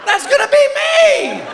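high, slow, panicked yelp; mock horror. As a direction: squeal in comic dread. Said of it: "I'm just laying there going".

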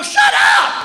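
very high, loud shrieked shut up; screamed shut up. Retold or imitated. Husband screaming at wife over guesses. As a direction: shriek it in total frustration. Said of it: "I go".

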